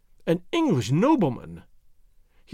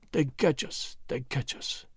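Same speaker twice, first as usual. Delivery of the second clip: barely audible, fading to a whisper; dying man breathing the words out; very low voice; 3 semitones lower, 7 dB quieter, slower than usual. A narrator's reading. Wounded Swede answering Tarzan, fading to a whisper. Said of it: "in a voice so low".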